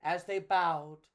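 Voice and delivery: usual speaking voice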